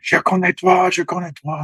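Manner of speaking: imitating a French officer, pitched well up; French officer's exclamation. French officer spotting his old legionnaire, high-pitched exclamation. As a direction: call out in French in sudden recognition, voice high. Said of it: "he's a French officer, and he's like".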